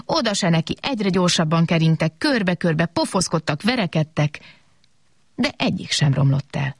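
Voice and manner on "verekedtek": ordinary delivery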